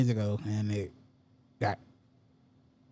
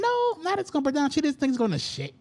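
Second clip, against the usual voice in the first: emphatic correction; 12 semitones higher than usual. Real, not acted. Correcting the listener.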